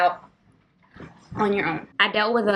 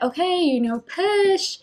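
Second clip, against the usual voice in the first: coaching nurse; 10 semitones higher, slower than usual. Retold or imitated. Staff coaching her to push.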